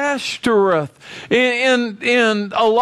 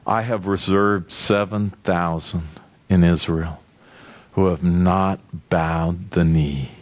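voice of God. Acted. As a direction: speak as the Lord, grave and measured. The Lord answers Elijah.

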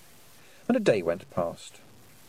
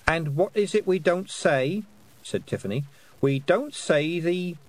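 slow, higher, leading reprimand; scolding prompt; 7 semitones higher, slower than usual. A narrator's reading. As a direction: coax the rule out of a naughty child. Sister scolding child for swearing.

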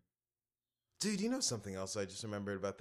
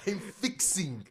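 high, slow, exaggerated delivery of a goofy line; mock comedian voice; 7 semitones higher, slower than usual. Friend mimicking the comedian's line, hammed-up and high. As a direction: ham up the comic's odd phrasing, drawn out.

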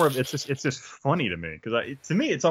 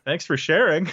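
high, louder, awkward mock thanks; mock-polite reply; 8 semitones higher, 8 dB louder than usual. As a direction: act out a thrown, over-polite brush-off. Acted reply to odd comment.

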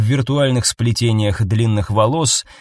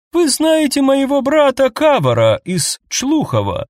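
too high elf voice; 14 semitones higher than usual. Acted. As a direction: speak in an unnaturally high voice. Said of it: "сказал эльф слишком высоким голосом".

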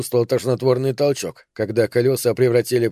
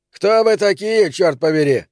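angry demand; 6 semitones higher, 3 dB louder than usual. Acted. Boss confronting the detectives.

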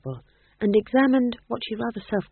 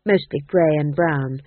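ordinary delivery

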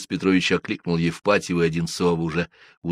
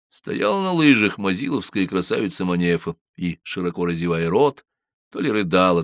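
usual speaking voice

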